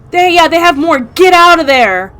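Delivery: ordinary talk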